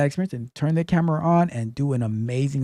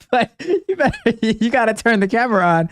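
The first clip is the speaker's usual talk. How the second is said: laughing; 6 semitones higher, 3 dB louder than usual. Real, not acted. Laughing through the impression.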